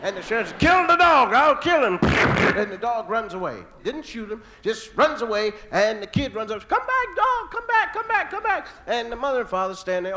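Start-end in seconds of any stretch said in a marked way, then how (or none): 0.6-2.0 higher, louder hammed-up sheriff yell; mock furious sheriff. Sheriff bitten by dog. 6.7-8.7 put-on much higher child's voice calling out; pleading kid. Kid calling runaway dog.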